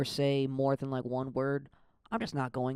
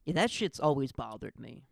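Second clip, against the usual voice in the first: ordinary talk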